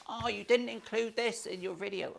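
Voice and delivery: high, soft whiny moan; put-on complaining whine; whiny commenter. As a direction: whinge like a petty online commenter. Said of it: "when I read the whiny comments".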